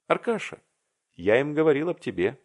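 high, sudden call to a friend; acted cry of sudden recollection. A narrator's reading. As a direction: blurt it out as if just remembered. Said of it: "закричал он вдруг, как будто только что вспомнил".

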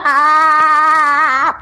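open-mouthed ah. Acted. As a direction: say ah for the doctor. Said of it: "Скажи «а»".

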